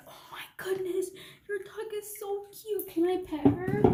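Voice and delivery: high, gushing coo over the dog; gushing friend. Retold or imitated. Imitating the friend cooing at the dog. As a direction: coo like she can't resist the dog.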